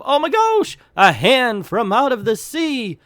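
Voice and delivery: high, loud startled cry; shouting lady. Acted. Lady on the lifeboat cries out at the hand from the sea, high and loud. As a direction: shriek it like a woman seeing a hand rise from the sea. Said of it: "shouts the lady".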